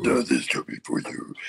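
imitating a scratchy Death voice; low, scratchy monster voice. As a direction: rasp it like a movie monster. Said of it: "That's exactly what it sounds like".

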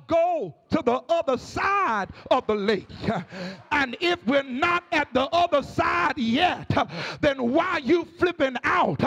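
rhythmic whooping sermon, each phrase hammered out over the organ; preaching at full force. Acted. Preacher urging the congregation to sleep and trust God, full whooping sermon. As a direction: rise into a sung preacher's cadence and drive it home.

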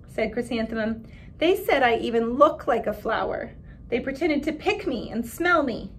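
put-on expressive read-aloud for children; story narration. A narrator's reading. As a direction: read the picture book to the class with voices. Reads picture book aloud to class.

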